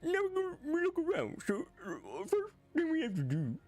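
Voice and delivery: very high, lilting, slurred Scandinavian parody voice; Swedish Chef. Acted. Streamer doing a redeemed impression while playing, high slurred chef voice. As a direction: slur the words in a swooping high singsong. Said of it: "The voice impression redeemed".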